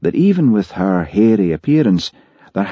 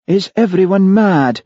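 incredulous wondering; 13 semitones higher, 5 dB louder than usual. Acted. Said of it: "wondered Hamish".

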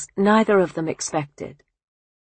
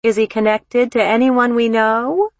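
high, loud, probing musing; 6 semitones higher, 6 dB louder than usual. Acted. Mother asking daughter about the fiancé's family, musing aloud. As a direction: lift it into a high, rising query. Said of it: "her mother mused".

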